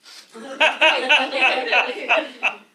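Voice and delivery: loud bursting laugh; big laugh. Real, not acted. Big laugh at own line.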